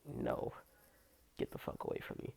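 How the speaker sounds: soft unvoiced brush-off; whispered rejection. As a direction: whisper it through your teeth. Rejecting pervert in locker room.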